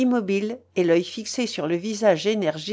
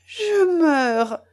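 high-pitched dramatic cry; a narrator's restrained high outcry; anguished cry; 10 semitones higher, 5 dB louder than usual. A narrator's reading. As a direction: cry out in agony, acted. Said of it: "s'écria Mélanie".

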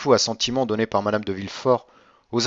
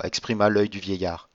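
ordinary delivery, nothing marked

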